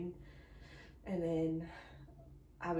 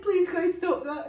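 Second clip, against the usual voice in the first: high, loud laughing plea; 10 semitones higher, 13 dB louder than usual. Retold or imitated. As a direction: plead while laughing. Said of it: "I was like".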